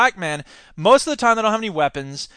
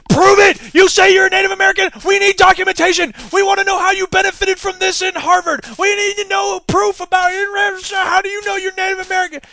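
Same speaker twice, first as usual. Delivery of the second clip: high, loud mock ranting demands; mocking frantic campaign; 11 semitones higher, 4 dB louder than usual. Mocking Brown's campaign hounding Warren, shrill ranting demand. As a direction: ham up a hysterical campaign demanding proof. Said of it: "the Scott Brown campaign just went nuts".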